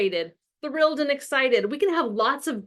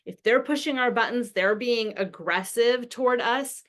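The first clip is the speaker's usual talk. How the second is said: ordinary delivery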